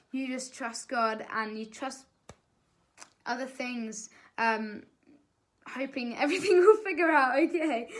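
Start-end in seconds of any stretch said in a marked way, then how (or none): 5.7-7.9 louder, higher, breaking into a real laugh. Real, not acted. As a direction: let a laugh lift the words. Laughs while voicing hope.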